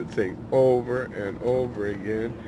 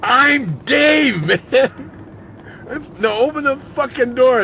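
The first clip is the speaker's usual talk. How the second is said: very high, loud furious yell; angry yelling; 11 dB louder, slower than usual. Retold or imitated. Dave locked out, screaming at his friend to open up. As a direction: bang on the door and scream. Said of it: "he started banging".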